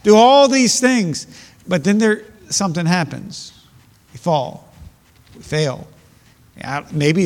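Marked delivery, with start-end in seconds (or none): 0.1-1.3 much higher and louder boasting vow; imitating a believer speaking bold, higher and louder. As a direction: swell up with big promises. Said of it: "we speak bold sometimes".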